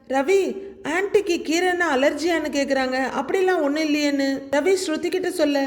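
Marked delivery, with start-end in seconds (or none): none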